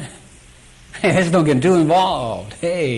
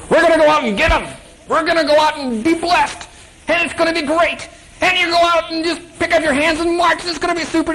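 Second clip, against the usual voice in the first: much higher, forced cheery rally voice; upbeat leader pep talk; 13 semitones higher than usual. Acted. Ezra rallying his followers while hiding his fear, much higher and chirpy. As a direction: put on a bright brave front. Leader putting on brave face.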